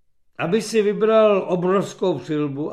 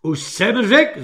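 irritated, raised and drawn out; cross, emphatic outburst; angry captain; 6 semitones higher, 7 dB louder, slower than usual. A narrator's reading. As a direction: put your foot down, louder and higher. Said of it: "zlobil se kapitán".

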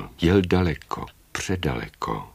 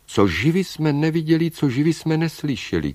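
high, chanting creature chorus; chorus in unison; 10 semitones higher, 3 dB louder than usual. A narrator's reading. Sea creatures answer the old woman together, high chant. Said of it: "svorně odpověděli".

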